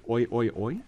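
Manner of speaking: ordinary talk, nothing marked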